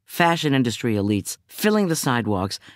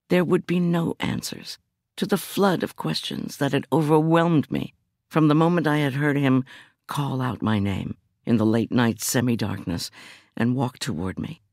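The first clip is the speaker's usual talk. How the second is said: plain reading voice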